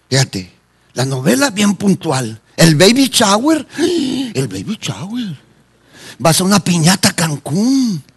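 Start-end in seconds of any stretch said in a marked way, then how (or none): 2.5-3.7 higher, drawn-out teasing delivery; mock enthusiasm. Preacher teasing churchgoers who never miss parties, mock excitement. 3.7-5.4 softer, very slow, after a gasp; gasp, then draw it out softly in mock wonder; mock gasping awe. Preacher mimicking a churchgoer thrilled by parties, gasping mock awe.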